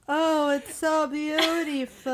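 high, drawn-out swooning wonder; mock awe. Host mimics actor gazing longingly at green screen, mock awe. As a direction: gush slowly over a fake view.

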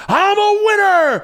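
slow, high, smug gloat. Retold or imitated. Shirtless winner gloating to the crowd at the raffle. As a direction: strut it like a champion. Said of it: "I'm walking up going".

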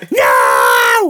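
long, loud drawn-out yell; imitated crowd yell at full volume; imitated heckler's shout. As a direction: bellow it like a booer in the crowd. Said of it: "Did you see one guy go".

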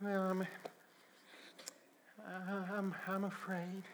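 soft, broken, stammering confession; timid and halting. Acted. Believer confessing his fear to God, halting and soft.

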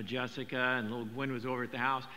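ordinary talk, nothing marked

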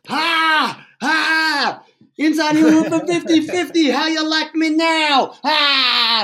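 high, loud triumphant crowing; ungracious gloating. Retold or imitated. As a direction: lord it over the rival fans. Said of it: "so ungracious".

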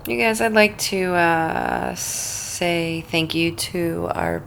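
slow, soft, drowsy read; sleepy slow. Real, not acted. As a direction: drag it out like half asleep. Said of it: "Are you falling asleep a little slowly?".

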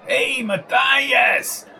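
put-on slow, high drunken screech. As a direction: stretch the name out in a high screech. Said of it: "screeching at Jones".

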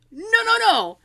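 high, loud indignant protest; shrieky retold objection; insistent denial. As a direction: shut it down loudly. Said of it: "I just got really insistent".

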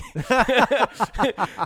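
high hammed-up laugh like his dad; hearty imitated dad laugh. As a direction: act out his dad cracking up. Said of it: "and he starts laughing".

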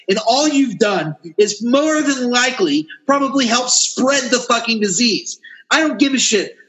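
ordinary delivery

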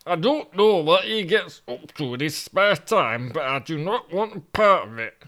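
a put-out northern man, lost in London; Toby grumbling in Yorkshire voice; grumpy Yorkshireman Toby. Acted.

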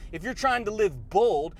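ordinary talk, nothing marked

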